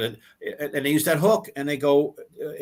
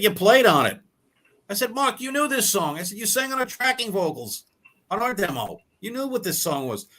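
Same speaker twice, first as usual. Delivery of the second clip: indignant confrontation; 8 semitones higher than usual. Retold or imitated. Confronting bandmate over stolen song.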